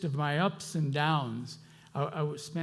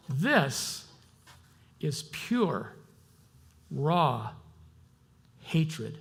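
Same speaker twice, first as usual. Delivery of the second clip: ordinary talk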